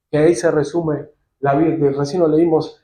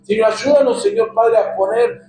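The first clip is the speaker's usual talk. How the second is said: fervent prayer; 5 semitones higher than usual. Acted. Pastor praying aloud for the congregation, fervent and pleading. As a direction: plead with the Lord, leading everyone in prayer.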